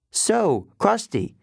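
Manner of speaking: high-pitched, forced casualness; trying to sound light. A narrator's reading. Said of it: "trying to keep my voice light".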